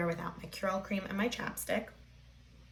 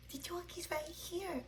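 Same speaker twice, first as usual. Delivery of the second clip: whispering; 9 semitones higher, 10 dB quieter than usual. Real, not acted. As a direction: whisper playfully to the puppy. Whispering to her dog.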